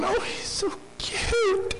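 very high, slow cooing; mock baby-talk coo over a lamb; cooing mockery. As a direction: coo at a fluffy lamb, mocking. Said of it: "and we're going".

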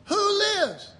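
high-pitched rallying question; high call-out. Retold or imitated. Preacher repeating the congregation's call, high call-out.

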